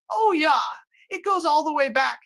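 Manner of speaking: squeaky excited aside; high mock exclamation. Host answering his own question in a high squeal. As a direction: jump way up high for a comic oh yeah.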